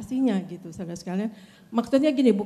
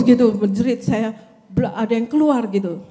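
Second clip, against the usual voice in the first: ordinary talk, nothing marked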